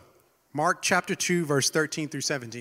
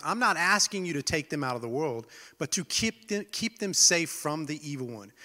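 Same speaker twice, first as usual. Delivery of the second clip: ordinary talk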